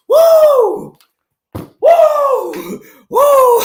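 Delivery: loud, high celebratory woo; triumphant hollering woo; gleeful whoops. Real, not acted. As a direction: whoop it up, gloating over the win. Gloating whoops after team's win.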